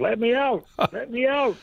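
very high parrot squawk; Rambo. Retold or imitated. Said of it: "Rambo squawked".

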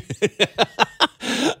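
high burst of laughter. Real, not acted. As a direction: laugh out in a quick run. Host laughing after a remark.

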